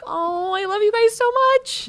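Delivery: high, cooing adoration; exaggerated fawning squeal; mock gushing. Retold gushing over the kids.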